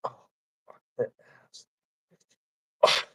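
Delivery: ordinary talk, nothing marked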